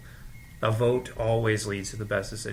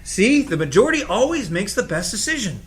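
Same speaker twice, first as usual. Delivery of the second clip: gloating excitement; 5 semitones higher, 4 dB louder than usual. Acted. Gloating over vote result.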